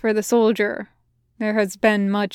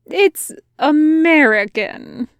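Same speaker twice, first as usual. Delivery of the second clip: slow, higher soldier voice, satisfied; soldier's voice; 6 semitones higher, 5 dB louder, slower than usual. A narrator's reading. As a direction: say it slowly, pitched up and pleased.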